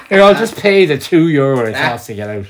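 usual speaking voice

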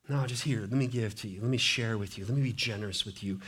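soft, low, generous coaxing; gentle offer. Acted. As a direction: press a gift on someone quietly. Voices a generous giver offering.

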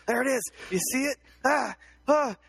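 high excited yelps; excited sighting. Acted. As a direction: point and yell at nothing. Acting out cryptid sighting.

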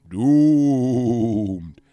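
long, theatrical horror-star wail; hammy, slow classic horror delivery; Bela Lugosi. Acted. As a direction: draw it out with vampire-movie menace. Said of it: "put a little Bela Lugosi in there".

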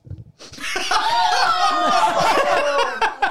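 big, loud laughing outburst; loud burst of laughter. Real, not acted.

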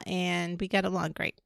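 ordinary talk, nothing marked

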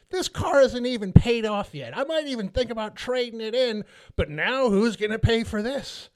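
high, put-on ranting Karen voice; Karen yelling. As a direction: rant on and on in a high-pitched Karen voice. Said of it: "this lady is still yelling at my wife".

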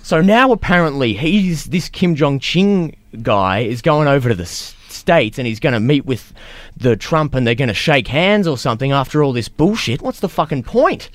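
hammed-up oafish rant; mock ranting coworker. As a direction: whine it like the dim coworker. Still voicing the coworker's rant.

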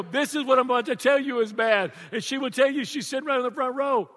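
ordinary talk, nothing marked

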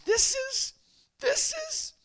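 very high, loud mock wail; mimicked crying. Weeping desert man to the preacher, hammed-up high wail. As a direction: squeal it out like a man bawling. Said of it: "And then he starts crying".

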